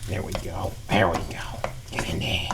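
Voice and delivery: soft whispered encouragement. Acted. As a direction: whisper it to the pan. Whispers to pan.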